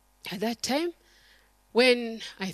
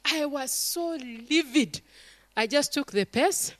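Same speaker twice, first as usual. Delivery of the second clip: normal talking voice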